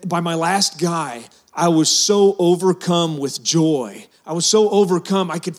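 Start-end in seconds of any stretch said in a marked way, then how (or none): 1.5-4.1 slow, with the word so drawn out and leaned on; stress on so. Real, not acted. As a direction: stretch "so" slowly and press it. Repeats line, lingering on so.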